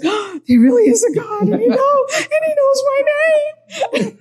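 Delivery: high, tearful laughter breaking through the words; overjoyed laughing cry. Retold or imitated. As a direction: laugh and cry at once, overwhelmed with joy. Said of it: "I said".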